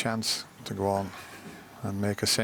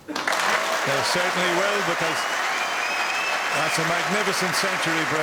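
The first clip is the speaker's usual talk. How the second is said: rising, louder thrill at the century; excited commentary; 7 semitones higher, 3 dB louder than usual. Real, not acted. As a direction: lift the voice in delight. Century break completed, commentator erupts.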